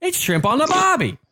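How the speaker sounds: high, jumping mock-Aussie voice; bad Australian accent. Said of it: "terrible accent. I apologize".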